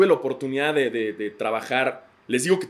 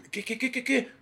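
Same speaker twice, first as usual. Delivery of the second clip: stammering shock; 12 semitones higher, 4 dB quieter than usual. Retold or imitated.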